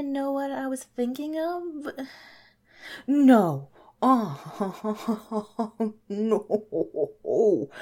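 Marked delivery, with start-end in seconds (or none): 3.1-7.7 being tickled. Real, not acted. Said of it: "No more tickling me".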